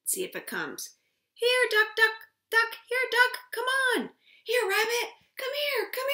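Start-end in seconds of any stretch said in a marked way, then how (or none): 1.3-6.1 high, loud calling out. Acted. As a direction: call the animal over sweetly. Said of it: "Let's call it".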